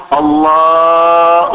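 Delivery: mock an imam overstretching الله; overstretched vowel. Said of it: "ويمدها".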